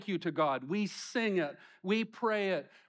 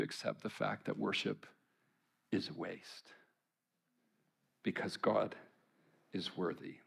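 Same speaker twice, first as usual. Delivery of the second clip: hushed; 8 semitones lower, 7 dB quieter than usual. Real, not acted. Solemn point about worship.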